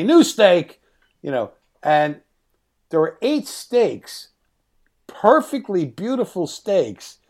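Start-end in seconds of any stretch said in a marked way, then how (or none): 0.0-0.8 called-out order. Retold or imitated. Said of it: "Then Marty'd say".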